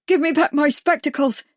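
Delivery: high, loud pleading cry; desperate cry. A narrator's reading. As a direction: cry out in panic. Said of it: "Bags cried".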